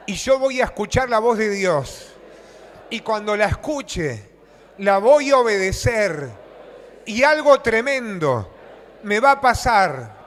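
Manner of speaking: loud, slow, fervent proclamation; call-and-response declaration. Real, not acted. Preacher leading the congregation in a declaration, loud and slow. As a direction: shout each phrase for the church to repeat. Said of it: "decí conmigo".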